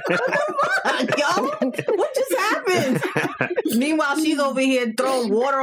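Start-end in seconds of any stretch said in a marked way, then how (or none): 0.1-3.0 high, drawn-out laughing disbelief; mock bafflement. Storyteller replaying confusion at her friend's flirting, laughing mock disbelief. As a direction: retell it in high-pitched, laughing shock.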